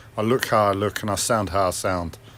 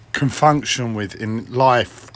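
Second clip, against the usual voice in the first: ordinary delivery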